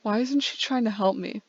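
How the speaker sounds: plain reading voice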